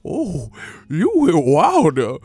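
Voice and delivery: slow, exaggerated caricature with pitch leaps; hammed-up period voice, long swooping vowels; mock freed slave. Streamer mocks rivals as freed slaves, caricature voice. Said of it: "talking like free slaves".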